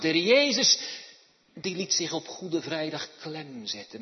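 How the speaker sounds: loud, high, driving sermon voice; fiery preaching. Acted. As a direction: preach it to the whole church. Preaches with full force. (vurig en luid preken)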